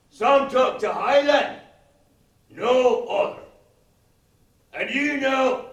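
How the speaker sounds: loud, high, slow Scottish declaiming; stretched-out, hammed-up Scottish limerick recital; Scottish persona. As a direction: bellow it like an angry mock Scot. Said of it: "assume my Scottish persona".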